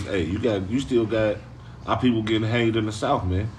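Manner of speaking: ordinary talk, nothing marked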